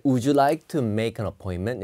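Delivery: "would you like some ice cream?" said slowly with a rise at the end; slow example sentence. Shown as a demonstration. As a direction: read the offer slowly, rising at cream. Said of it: "앞부분을 잘 드셔야 됩니다". (천천히 끝을 올려 읽는 예문)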